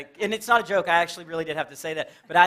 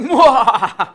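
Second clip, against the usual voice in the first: jump-scare shout; 4 semitones higher, 14 dB louder than usual. Retold or imitated. Kid about to jump-scare.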